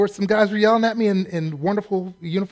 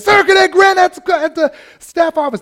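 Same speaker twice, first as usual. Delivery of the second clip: high, loud military report; cadet shouting report; 13 semitones higher, 10 dB louder than usual. Retold or imitated. Fellow cadet shouting his report, loud high bellow. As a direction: bellow it at attention.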